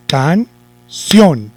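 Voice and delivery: "Canción" slowly split, pause, then emphatic CIÓN; stress on CIÓN. Shown as a demonstration. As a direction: break Canción in two and hit the ending. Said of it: "lleva el acento en sion". (Canción separada, con fuerza en ción)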